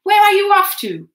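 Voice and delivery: high, loud call after him; shout. A narrator's reading. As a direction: shout across the slope. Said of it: "shouted Hubert".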